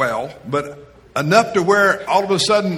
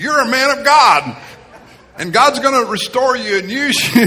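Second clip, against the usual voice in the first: much higher, louder, laughing proclamation; prophetic declaration; 9 semitones higher, 7 dB louder than usual. Retold or imitated. Woman prophesying over the carpet cleaner, laughing, excited. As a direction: declare it with joyful conviction. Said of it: "She looks at me and says".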